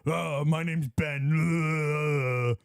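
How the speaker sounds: dopey tough guy voice; mocking Ben. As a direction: mimic him as a dumb tough guy. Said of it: "talking like me now".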